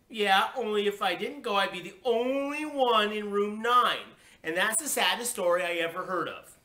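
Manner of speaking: high, loud, aggrieved wail; child's wailing protest. A narrator's reading. Child pities herself before parents, wailing. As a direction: wail it, stretching the key words.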